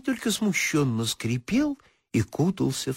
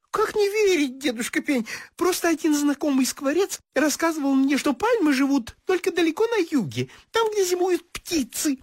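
much higher chirpy little bird; titmouse voice. Acted. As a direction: chatter like a small eager bird.

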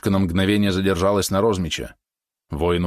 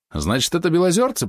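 high, bright hopeful question; lighting up with relief; просиял; beaming; 10 semitones higher than usual. A narrator's reading. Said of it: "просиял Вихруша".

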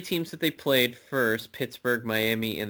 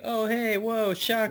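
sarcastic; 10 semitones higher than usual. Pretend. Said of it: "It's like".

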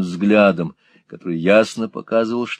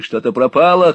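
shouting; 5 semitones higher, 6 dB louder than usual. A narrator's reading. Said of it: "крикнул Ральф".

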